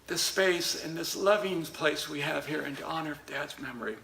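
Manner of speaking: ordinary talk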